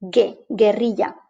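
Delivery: gue, guerrilla with hard G and no U sound; Spanish gue, guerrilla with the U dropped; silent U. Shown as a demonstration. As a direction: say gue, guerrilla as "geh, geh-RREE-ya". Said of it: "which is not pronounced".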